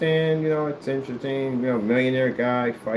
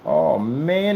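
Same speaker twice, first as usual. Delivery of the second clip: usual speaking voice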